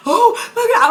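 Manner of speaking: high, loud mock sob; re-enacted crying. Telling how the dream made her cry, acted wail. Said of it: "I was, like, crying".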